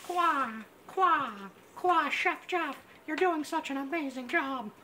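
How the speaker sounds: high, squawking turkey gobble. Acted. The roasting bird praising Chef Jeff, high squawk. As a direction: squawk it out like a proud talking turkey. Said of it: "the bird is sitting there going".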